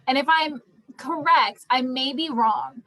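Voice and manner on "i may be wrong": normal talking voice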